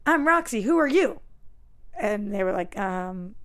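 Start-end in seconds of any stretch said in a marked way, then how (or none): 0.1-1.2 put-on high, eager little girl; little kid voice. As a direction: play an excited six-year-old. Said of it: "and I'm like".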